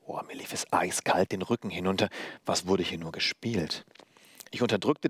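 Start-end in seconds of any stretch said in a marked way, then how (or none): none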